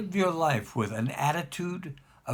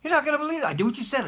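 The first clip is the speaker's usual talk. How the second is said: much higher and louder, bubbling with excitement; giddy; 12 semitones higher, 3 dB louder, much faster than usual. Retold or imitated. Grateful caller phoning the rabbi at night, giddy. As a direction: burst out with giddy news. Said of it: "he's almost giddy on the phone".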